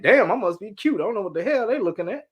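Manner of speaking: a sarcastic brag aimed at people who stare; passive aggressive. Retold or imitated. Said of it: "passive aggressive".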